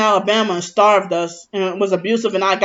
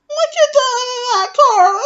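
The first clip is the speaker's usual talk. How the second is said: mocking Mickey's voice. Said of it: "she talked like this".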